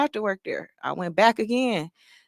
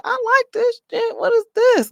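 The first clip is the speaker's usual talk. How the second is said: excited discovery; 5 dB louder than usual. Retold or imitated. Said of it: "And I'm like".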